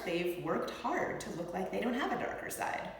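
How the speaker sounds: usual speaking voice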